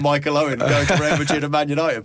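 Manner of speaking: words breaking into laughter; laughing. Real, not acted. Joking about Michael Owen betrayal.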